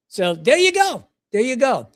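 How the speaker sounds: high, lilting Irish sign-off; mock Irish accent. Traveler wrapping up his pharmacy story, mock Irish accent. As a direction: toss it off in a playful Irish voice.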